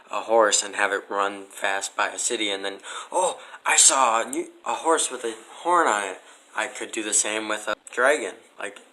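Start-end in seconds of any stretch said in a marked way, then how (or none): 3.1-6.2 lightly voiced, higher excited onlooker; a hint of a wide-eyed bystander; mock gullible witness. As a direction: play the fooled townsperson, a little higher. Imagined witness quote.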